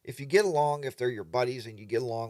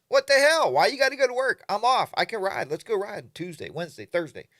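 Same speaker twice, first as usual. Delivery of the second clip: much higher, louder indignant protest, easing off toward the end; mock outrage; 8 semitones higher, 7 dB louder than usual. To buddies who must work, a hammed-up outraged protest. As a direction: act outraged that nobody's free.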